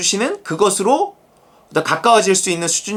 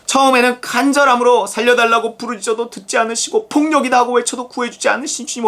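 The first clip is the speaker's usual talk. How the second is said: usual speaking voice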